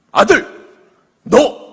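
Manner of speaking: loud, high, abrupt call to the son; commanding shout with a pause between words; stern father. Acted. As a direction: call him to attention, word by word.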